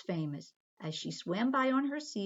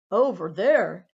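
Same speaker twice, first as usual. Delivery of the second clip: loud call; 12 dB louder, slower than usual. Acted. Daddy points to the aquarium.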